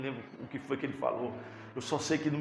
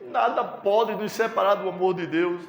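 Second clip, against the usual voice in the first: raised, louder preaching cry; emphatic proclamation; 6 semitones higher, 7 dB louder than usual. Real, not acted. Preacher repeating the verse to the congregation, emphatic proclamation. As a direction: proclaim it high and forcefully.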